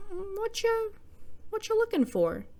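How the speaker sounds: a stranger with a knife menaces John, high put-on voice; menacing Hashmi. Hashmi asks John in a high mock-creepy voice.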